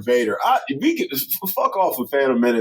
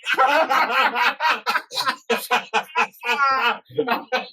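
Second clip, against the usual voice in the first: higher laughing imitation of Vader's cry; mock Vader wail; 8 semitones higher than usual. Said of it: "And he yells".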